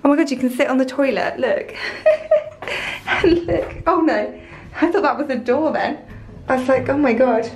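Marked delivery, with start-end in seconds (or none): 1.8-3.0 high breathless giggle; a quick airy laugh at the joke; real amused giggle. Real, not acted. Laughs at toilet view.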